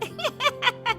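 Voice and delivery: high-pitched wicked laugh; gloating cackle. Acted. Friend boasts of burning her daughter-in-law's face, gloating laugh. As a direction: cackle with malicious glee.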